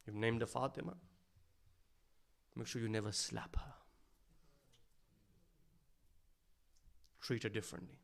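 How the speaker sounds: quiet tearful tender warning; hushed grief. Acted. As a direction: let it sink almost to a murmur. Imam's tearful, tender advice.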